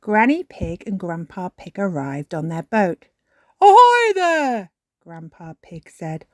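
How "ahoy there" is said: loud, high, drawn-out hail; calling out. A narrator's reading. Grandpa Pig arrives by boat, calling out loudly. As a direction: hail them from afar, long and loud.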